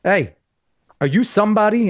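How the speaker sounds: a hint of a cocky young comic; Dave Chappelle voice. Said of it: "in Dave's way".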